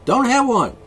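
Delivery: high, loud payoff; loud punchline. Real, not acted. Ranting host hammers his point at anti-abortion listeners. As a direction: shout the answer home.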